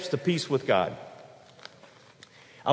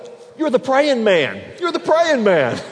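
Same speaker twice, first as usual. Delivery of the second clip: high, loud delighted exclamation; waitress recognising the regular, calling out loud and high; excited greeting; 10 semitones higher, 9 dB louder than usual. Retold or imitated.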